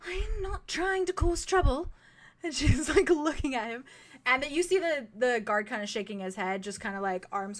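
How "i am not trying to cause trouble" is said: put-on high, airy, pleading young woman; light airy bard girl. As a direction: voice the bright tiefling begging to get through. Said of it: "she says".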